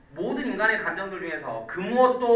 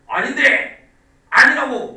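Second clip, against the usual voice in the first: loud, high acted protest; mock-defensive outburst; indignant denial; 6 semitones higher, 12 dB louder, slower than usual. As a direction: deny it hotly, raising pitch and volume. Acts out a jealous partner's denial.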